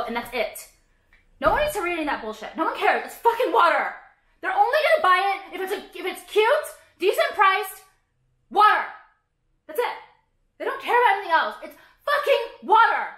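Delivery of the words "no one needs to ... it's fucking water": high, loud building rant; heated rant. Real, not acted. Ranting to viewers about water bottle branding, yelling. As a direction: climb into a full exasperated yell.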